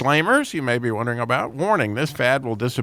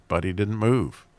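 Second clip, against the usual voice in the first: ordinary delivery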